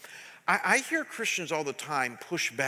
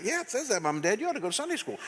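a hint of a know-it-all kid; child's voice; 5 semitones higher, much faster than usual. A second grader telling Mom and Dad, lightly voiced child. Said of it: "That's what your kid's gonna say to you".

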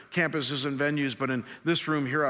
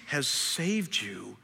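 slow emphasis; much slower than usual. Real, not acted. Preacher slowing on God's mercy.

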